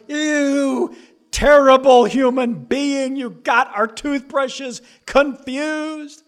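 put-on high, loud disgusted child, slow at the end; mock disgust. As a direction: sneer it like a grossed-out kid. Kid disgusted at sibling.

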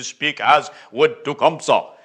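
put-on stilted stage Indian accent, word by word; Indian-sounding accent. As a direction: speak like an outdoor-drama Tecumseh with a mock Indian accent. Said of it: "their Indian-sounding accent".

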